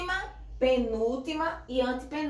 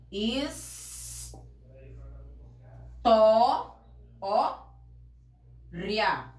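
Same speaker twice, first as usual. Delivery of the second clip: "Is, to, ó, ria" sílaba por sílaba, com pausas longas; sílabas separadas; 2 semitones lower, much slower than usual. Shown as a demonstration. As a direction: separe bem cada pedaço de "Is, to, ó, ria". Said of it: "você separa a sílaba". ("Is, to, ó, ria" pronunciado pausadamente, em sílabas)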